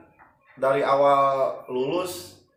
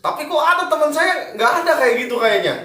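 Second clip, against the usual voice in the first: excited; 13 semitones higher, 6 dB louder, faster than usual. Real, not acted. Surprised, high fast objection.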